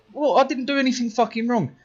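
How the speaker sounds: high-pitched, aggrieved objection; indignant protest. Retold or imitated. Pupil told he is suspended, protesting indignantly. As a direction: protest your innocence, voice pitched right up.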